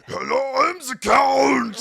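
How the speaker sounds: booming gravelly shout, drawn out on Count; hammed-up monster roar, very loud; deep, gravelly voice. The Count greeting guests from the stairs, deep gravelly voice. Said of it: "a deep, gravelly voice".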